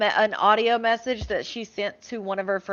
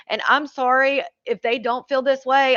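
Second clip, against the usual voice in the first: normal talking voice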